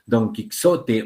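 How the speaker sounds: "Quijote" with KS instead of the J; "Quijote" read with a literal X; old spelling read literally. Shown as a demonstration. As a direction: sound out "Don Quijote" slowly as "don kik-SO-te". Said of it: "no es que en ese entonces se pronunciase".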